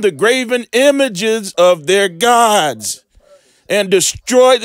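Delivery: drawn-out, weighty reading of the verse; slow solemn scripture reading. Acted. Preacher reading the verse to viewers, slow and weighty. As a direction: lean on every command slowly.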